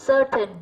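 Certain stressed on "Cer"; first-syllable stress. Shown as a demonstration. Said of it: "rơi vào âm một". (nhấn âm tiết đầu của Certain)

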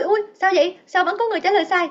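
ordinary talk

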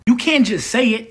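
usual speaking voice

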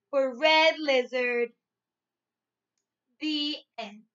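put-on loud, lively storybook reading for young kids; reading to children. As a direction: read it out big and bright, like to a class of little ones. Teacher reads storybook to class.

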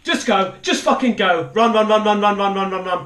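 loud, high frantic repeats; real panic, words tumbling out loudly; panicked urging. Real, not acted. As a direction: urge yourself to flee in a panic. Fleeing a monster in game.

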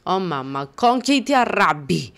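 ordinary talk

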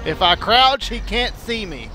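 usual speaking voice

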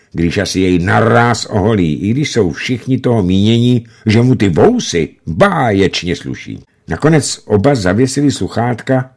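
usual speaking voice

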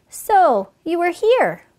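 high, loud storybook exclamation; tiger's exclamation. A narrator's reading. Hungry tiger finding the trapped fox, loud exclamation.

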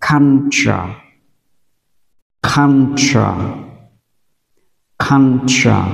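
"culture" said singly, "ah" first, then "chuh"; model pronunciation. Shown as a demonstration. Teacher has learners repeat "culture", modelling "ah" and "chuh". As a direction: say "culture" as CAHN-chuh, pausing after each. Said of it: "các bạn đọc lại theo tôi nào".

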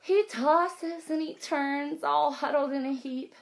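lively, sing-song picture book read; reading to children. A narrator's reading. Teacher reading aloud to kids, lively sing-song. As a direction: draw the little ones into the story.

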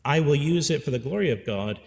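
normal talking voice

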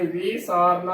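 ਵੀ ਸਾਰਨਾ opening on "vee"; wrong long-i reading. Shown as a demonstration. Teacher showing the wrong reading, ਵੀ ਸਾਰਨਾ with long "vee". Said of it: "ਉਚਾਰਨ ਨਹੀਂ ਕਰਨਾ".

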